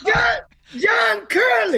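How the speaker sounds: high, slowed, shouted name of a long-lost friend; shouting his name with real joy; joyful shout. Real, not acted. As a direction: call his name across the room, overjoyed. Reunion calling out friend's name.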